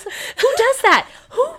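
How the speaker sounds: high, slow, outraged disbelief; incredulous. Real, not acted. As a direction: stretch it out in disbelief. Laughing disbelief at brother.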